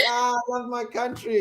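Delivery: high, gushing retold sigh of happiness; delighted returnee. Traveller just welcomed back to South Africa, high and delighted. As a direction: gush with mock-swooning love, voice lifted. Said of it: "I was like".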